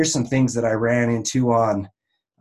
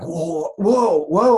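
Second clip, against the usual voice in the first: mock startled whoa; 5 semitones higher, much slower than usual. Reacting to hook.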